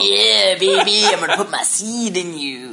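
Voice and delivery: laughing sleazy villain. Retold or imitated. Imitating Randall Flagg, laughing.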